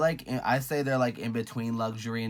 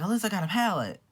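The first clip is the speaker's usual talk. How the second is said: high, loud retold astonishment; loud, high-pitched disbelief; shocked reaction; 10 semitones higher, 5 dB louder, faster than usual. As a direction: exclaim it like startling news. Retelling own shocked reaction.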